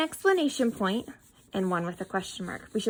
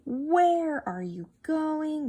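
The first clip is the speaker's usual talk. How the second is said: WHERE stretched out in a slow, higher question; 5 semitones higher, 3 dB louder, much slower than usual. Shown as a demonstration. Teacher showing how we ask a question, slow questioning. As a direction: draw out WHERE to open the question. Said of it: "We're asking a question".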